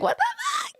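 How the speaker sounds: sudden high yelp of disbelief; shocked outburst. Retold or imitated. Reacting to seeing the earnings.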